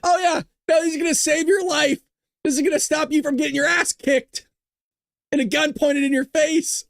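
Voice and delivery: laughing sarcasm about panic buttons; sarcastic glee. Real, not acted. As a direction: ridicule the panic-button idea.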